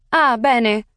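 put-on thick Italian accent. A narrator's reading. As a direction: give her a thick Italian accent, polite and formal. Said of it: "A woman with a thick Italian accent".

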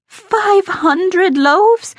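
very high, drawn-out incredulous question; aghast disbelief. A narrator's reading. Cook repeating the aldermaston's order back, aghast. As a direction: stretch it out high in astonishment.

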